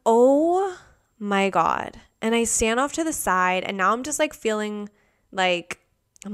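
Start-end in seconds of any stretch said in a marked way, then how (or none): 0.0-2.0 overjoyed amazement. Retold or imitated. Customer given free croissant, hammed-up amazement. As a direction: let the first word soar, then drop.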